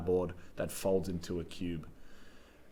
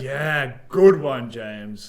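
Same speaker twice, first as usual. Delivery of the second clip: loud sarcastic praise, punched on "good one"; 3 semitones higher, 11 dB louder, much slower than usual. Real, not acted. Co-host answering a bad title pitch, sarcastic. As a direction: praise the bad joke with mock enthusiasm. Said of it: "Was that sarcastic?".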